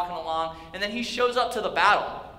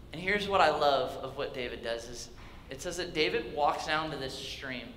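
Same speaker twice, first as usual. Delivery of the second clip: usual speaking voice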